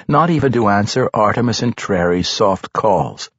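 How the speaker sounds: ordinary delivery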